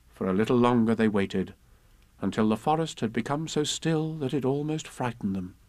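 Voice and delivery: ordinary delivery, nothing marked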